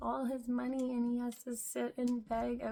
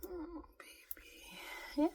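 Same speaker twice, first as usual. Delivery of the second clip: soft whisper to the dog; 6 semitones higher, 5 dB quieter, much slower than usual. Real, not acted. Cooing to the dog.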